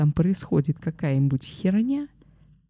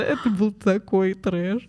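ordinary delivery

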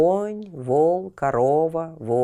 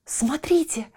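put-on high excited child voice; delighted boy; 11 semitones higher than usual. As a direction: squeal with joy at the bird. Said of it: "обрадовался Тиль-Тиль".

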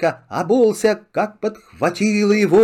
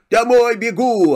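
shouting; 6 semitones higher, 3 dB louder than usual. Acted. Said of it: "кричит".

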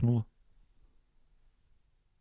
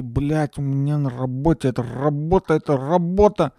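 whiny stressed office worker; 5 dB louder than usual. Retold or imitated. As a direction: moan about work, building louder. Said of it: "они такие".

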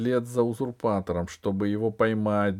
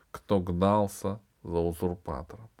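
ordinary delivery

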